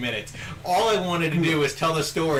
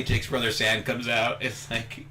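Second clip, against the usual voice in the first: usual speaking voice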